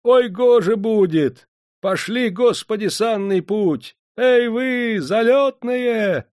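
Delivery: high, loud, slow drawn-out holler; slow, loud, high coachman whooping; coachman's cry. Acted. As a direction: urge the horses on with a wild cry. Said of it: "выкрикнул".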